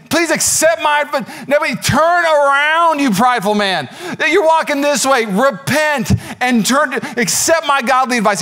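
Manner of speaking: high, insistent preacher's plea; urgent pleading. Acted. Daniel begs the prideful king to repent, urgent. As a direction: implore him, pitched right up.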